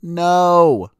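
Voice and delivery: a loud high flat refusal; emphatic no. Real, not acted. Vlogger rejecting the professor's reasoning, emphatic loud no. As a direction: shut the idea down cold.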